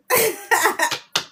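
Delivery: bright burst of laughter; loud amused laugh; real laugh. Real, not acted. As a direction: laugh out at the joke. Laughs at her own joke.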